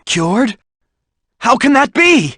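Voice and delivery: can't believe the news; high stunned question; astonished. Acted. Hears sister was cured.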